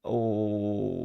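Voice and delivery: ordinary talk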